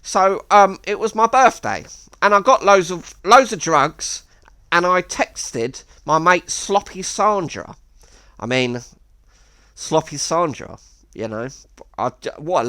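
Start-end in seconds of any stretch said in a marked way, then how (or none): 0.0-7.8 higher mock voice of a drugged-up mate; lightly voiced, higher bragging punter; imitating Miles. Regular at the betting shop, bragging, in a higher mock voice. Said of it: "so he's like this".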